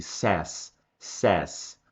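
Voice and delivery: "says" said as "sés", short open e; pronúncia de says. Shown as a demonstration. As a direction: model "says" as "sés", falling. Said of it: "muita gente tem dificuldade de falar". ("says" pronunciado "sés", com e aberto)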